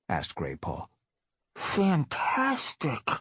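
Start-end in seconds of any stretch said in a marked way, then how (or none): 1.5-3.1 mumbled through a mouthful; slow, chewing while speaking; mouth full. Acted. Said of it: "mumbled Firepaw, his mouth still full".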